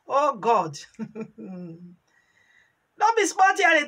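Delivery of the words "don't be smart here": much higher and louder, sharp rebuke; mock scolding shout. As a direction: scold like a boss telling you off. Quoting a scolding.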